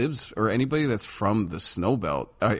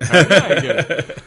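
high, louder, laughing question; laughing disbelief; 8 semitones higher, 11 dB louder than usual. Retold or imitated. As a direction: laugh it out, amazed he understood. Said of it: "And I was like".

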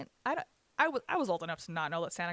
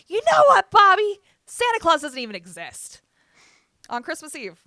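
full-force high yell, easing toward the end; screaming mom; 10 semitones higher, 17 dB louder than usual. Retold or imitated. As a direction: imitate an enraged mother screaming. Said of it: "She's screaming at my dad".

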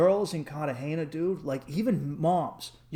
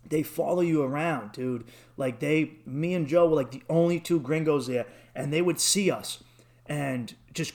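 ordinary delivery

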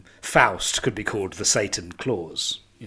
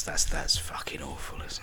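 whispered; 7 semitones lower, 7 dB quieter than usual. Real, not acted. Mock disgust at bad pun.

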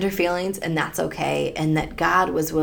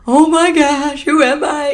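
louder, higher mock panic; 10 semitones higher, 10 dB louder than usual. Woman imagining DNA results shaking her identity, alarmed. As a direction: cry it out in alarm. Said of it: "It's like".

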